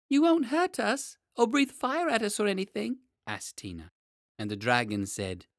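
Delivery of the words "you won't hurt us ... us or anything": put-on timid little girl. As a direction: ask nervously like a small girl. Tina asks the dragon.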